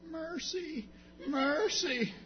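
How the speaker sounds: put-on high, small child's plea; little girl pleading. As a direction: beg like a three-year-old afraid of a spanking. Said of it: "she only said one word".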